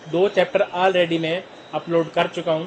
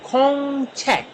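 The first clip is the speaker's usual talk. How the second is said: slow "खोंग छेक", held high, then falling; syllable by syllable; 6 semitones higher, much slower than usual. Shown as a demonstration. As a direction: stretch "खोंग" before landing on "छेक". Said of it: "दो syllable से लफ्ज". ("खोंग छेक" को धीरे, खींचकर)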